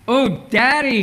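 put-on high, panicked little girl's squeal; squealing in horror. A narrator's reading. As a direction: squeal it like a child who sees the bee. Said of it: "she squealed in horror".

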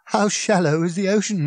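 lifted, incredulous comeback; hollow irony. A narrator's reading. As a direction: ask it as bleakly absurd. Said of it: "He gave a hollow laugh".

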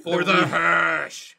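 slow, solemn war cry; rally the troops, slowly; mock battle cry.

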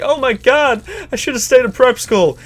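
character mock-fretting about prep school; mock posh panic. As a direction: voice the disturbed character. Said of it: "His face is like".

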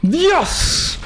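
high, sudden yelp of shock; a jolt of real fright; startled cry. Real, not acted. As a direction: blurt it out in alarm. Panic during the fight.

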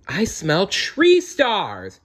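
high, drawn-out cry of discovery; excited cry. A narrator's reading. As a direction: call out the find, stretching the words. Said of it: "he cried".

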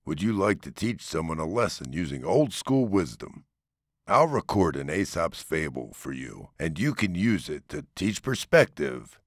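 plain reading voice

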